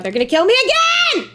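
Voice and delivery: high, loud frantic cry; loud, high panicked outburst; panicked yelling. Real, not acted. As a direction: shout it in desperate panic. Enemies attacking, panicking.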